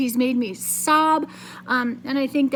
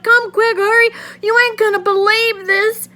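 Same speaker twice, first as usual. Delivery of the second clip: high, loud and urgent; excited shout; 9 semitones higher, 8 dB louder than usual. Acted. Billy urging his mother to come see, excited loud shout. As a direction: shout at her to hurry over. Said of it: "I shouted".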